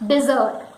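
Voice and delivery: "dessert" alone as dih-ZERT; correct dessert. Shown as a demonstration. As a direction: say "dessert" with a short first vowel, dih-ZERT. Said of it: "it is dessert".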